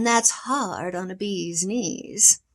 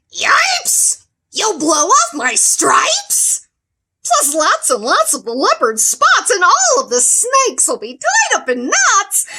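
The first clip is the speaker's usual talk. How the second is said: high, loud alarmed yelling; 9 semitones higher, 10 dB louder than usual. Acted. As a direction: yell out in alarm. Zebra yells.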